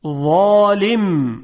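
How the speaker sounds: ظالم with a thick, emphatic ظ; heavy za. Shown as a demonstration. Teacher demonstrates the heavy ظ in ظالم. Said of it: "با حرف ظا".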